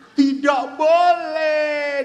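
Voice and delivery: slow, loud, high refusal, dragged out; loud stretched-out no from a scandalised wife; outraged refusal. Acted. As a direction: drag out the no in shock. Wife refuses husband's second marriage.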